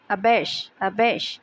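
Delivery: dictionary-style अबैश, pitch dropping at the end; pronunciation demo. Shown as a demonstration. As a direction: model अबैश on its own, a-BASH. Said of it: "pronunciation हो जाएगा".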